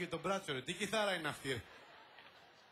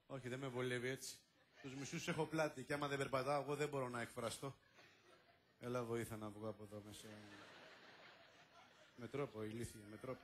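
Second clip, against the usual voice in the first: fast, much softer, lower asides; whispered backstage scolding; hushed aside; 6 semitones lower, 9 dB quieter, much faster than usual. Acted. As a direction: grumble quickly under the breath. Hissed asides to a helper.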